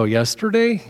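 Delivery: YESTERDAY pitched high in mock disbelief; incredulous echo. Pastor questioning an angry husband's answer, incredulous YESTERDAY. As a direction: echo YESTERDAY back, voice leaping up.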